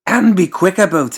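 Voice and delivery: high, loud, bossy mad-doctor voice; pompous doctor. Acted. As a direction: order the servant about from up high.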